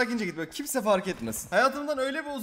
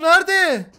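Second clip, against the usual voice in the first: shouting; 9 semitones higher, 10 dB louder than usual. Real, not acted. Searching for enemy in panic.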